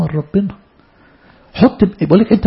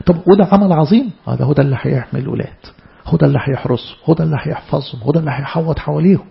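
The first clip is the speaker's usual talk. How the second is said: ordinary talk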